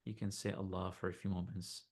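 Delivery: soft, unhurried meditation voice; guided meditation. Acted. Teacher leading listeners to notice body and heart, calm meditation voice. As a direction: gently guide them inward, leaving long silences.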